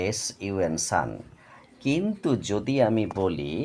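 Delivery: usual speaking voice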